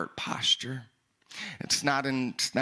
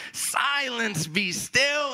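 higher-pitched command, drawn out; stern rebuke; 8 semitones higher, much slower than usual. Acted. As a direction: command the waves to settle, without shouting. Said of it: "he rebuked the wind".

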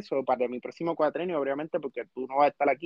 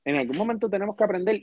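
ordinary delivery

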